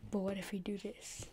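sly soft whisper; conspiratorial whisper to the viewer. Real, not acted. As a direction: murmur it like a secret plan. Sly idea while coding.

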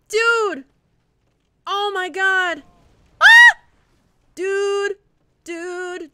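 high panicked screams; screaming. Real, not acted. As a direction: scream at the screen in terror. Panic during game fall.

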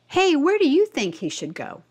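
ordinary delivery, nothing marked

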